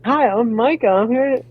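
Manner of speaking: high, sugary customer voice; a fake sweet salesman voice; overly sweet greeting. Shown as a demonstration. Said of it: "I won't be like".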